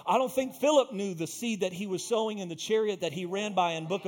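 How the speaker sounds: high, driving sermon fervour; full-force preaching. Real, not acted. Preacher urging believers to sow seed, fervent preaching. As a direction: exhort the congregation with mounting energy.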